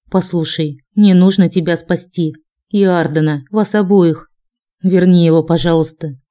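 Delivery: plain reading voice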